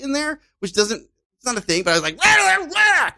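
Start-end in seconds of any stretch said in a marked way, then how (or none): 2.2-3.1 much higher, louder wild yell of nonsense; incoherent yell. Retold or imitated. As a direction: scare him off with a crazed shout. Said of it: "I yelled again, something very incoherent".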